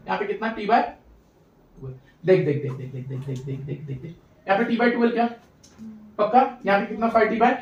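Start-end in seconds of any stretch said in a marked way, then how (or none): 2.3-4.1 very low, soft monotone chant; hushed repeated prompting. Pretend. Teacher urging students to look, low fading murmur. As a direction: murmur the word over and over, fading.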